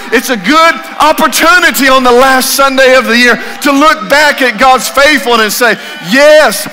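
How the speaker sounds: loud, high, driving sermon delivery; preaching at full force. Acted. Preacher urging the church to review God's faithfulness, full-force preaching. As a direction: preach it out big and rising.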